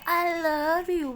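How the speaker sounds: drawn-out, high, loud taunt; mocking imitation. Girl quoting her friend's overheard words back at her, mocking. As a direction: stretch it out to tease her.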